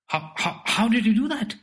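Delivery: can't believe the trick; disbelief. Acted. Boy asks how the ball vanished, stammering disbelief. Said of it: "asked Zip Tucks in disbelief".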